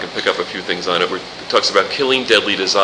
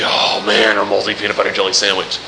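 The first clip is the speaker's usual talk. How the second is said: quick, dreamy mock longing; hammed-up hungry sigh; mock craving. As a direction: pretend you're drooling over it. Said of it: "you ever look at that and crave it and say".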